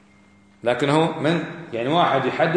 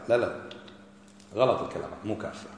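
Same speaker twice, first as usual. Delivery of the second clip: quieter, dismissive imitation of a denier; a lightly voiced scoffing objector; mock objector; 8 semitones lower, 10 dB quieter than usual. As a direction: brush it off softly like the objector.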